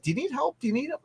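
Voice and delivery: high, soft retold offer; light, high-voiced helpful question; eager offer. Retells offering to help cook.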